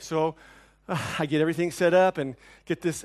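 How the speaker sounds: usual speaking voice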